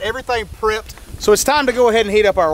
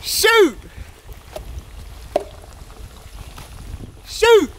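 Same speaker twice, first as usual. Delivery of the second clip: startled yelps; 11 semitones higher, much slower than usual. Real, not acted. Cook startled by hot grease splattering, yelping shoot. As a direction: jump back from spitting oil.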